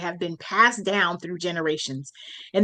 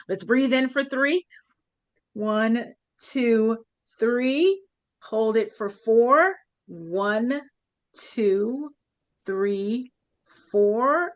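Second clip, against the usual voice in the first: guided breathing count; much slower than usual. Shown as a demonstration. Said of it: "take a couple of deep breaths".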